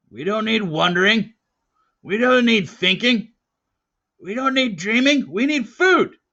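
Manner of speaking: loud, high, hammering each line; gruff. Acted. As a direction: lecture the boy harshly, building. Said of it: "interrupted gruffly".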